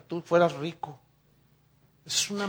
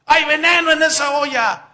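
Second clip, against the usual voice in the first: loud, high cry that the pot is poison; alarmed shout; 8 semitones higher, 11 dB louder than usual. Acted. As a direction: shout the warning across the room. Prophets' sons cry out warning.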